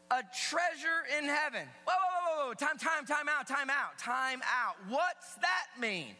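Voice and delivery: high, loud sermon voice; emphatic preaching. Real, not acted. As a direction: preach it to the whole room with punch. Preaches at full voice.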